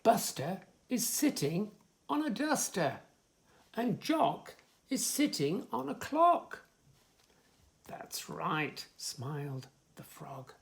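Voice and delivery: sing-song rhyming list; reading to children. Acted. Reader lists rhymes for a child, sing-song. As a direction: bounce through the rhymes for little ones.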